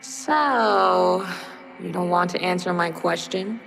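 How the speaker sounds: low, menacing spider voice; threatening. Acted. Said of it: "in a threatening tone".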